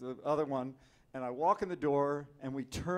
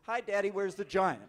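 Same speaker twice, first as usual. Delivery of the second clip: imitating excited kid; 6 semitones higher, 5 dB louder than usual. Kids at airport greeting.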